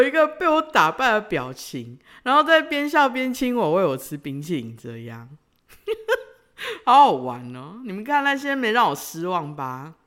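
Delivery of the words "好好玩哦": laughing it out gleefully. Real, not acted. To listeners, delighted laughter at her own story. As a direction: giggle through the words.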